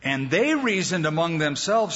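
ordinary delivery

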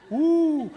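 a drawn-out, high woo of mock awe; mock-impressed whoop. Pastor making fun of a chaplain badge, mock-impressed whoop.

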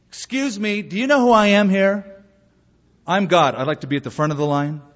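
lightly acted self-important queue-jumper, high at first; mock bragging. Jesus imagined asking for front of line, mock boastful. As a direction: play at pulling rank, starting high. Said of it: "ever remember him saying".

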